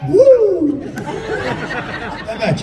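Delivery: high hooting woo; mock whoop. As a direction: whoop like a wild drunk. Patient whoops at question.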